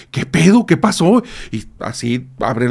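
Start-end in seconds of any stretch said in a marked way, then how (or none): 0.1-1.2 higher, louder startled questions; jumpy, rattled, what's going on; nervous alarm. Retold or imitated. Wife startled in the shower answering her husband, nervous and higher. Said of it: "se pone con los nervios de punta".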